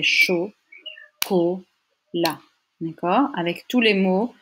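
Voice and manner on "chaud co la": each syllable on its own, clear gaps between. Shown as a demonstration. Teacher cutting a French word into parts, syllable by syllable. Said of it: "comment est-ce que ça se coupe en syllabes".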